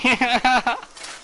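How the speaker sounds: high, loud burst of laughter. Real, not acted. Amused by another player's reaction.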